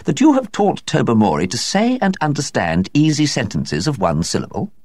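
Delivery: high-pitched doubtful society woman; high lady's voice. A narrator's reading. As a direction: ask in a high incredulous lady voice. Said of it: "asked Miss Resker".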